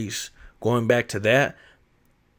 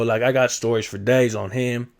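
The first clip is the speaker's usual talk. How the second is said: normal talking voice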